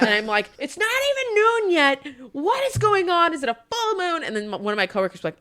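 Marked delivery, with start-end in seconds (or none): 0.6-4.2 very high-pitched mock wail; high, hammed-up exasperation; exasperated disbelief. Retold exasperated rant to friends.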